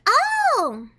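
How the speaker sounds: high surprised oh. Real, not acted. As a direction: gasp it out. Surprised reaction.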